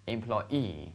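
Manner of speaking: "employee" with the last syllable stressed and drawn out; light start, long stressed "-ee" in "employee"; stress on final EE. Shown as a demonstration. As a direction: model "employee" slowly, leaning on "-ee". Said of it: "把重音读出来".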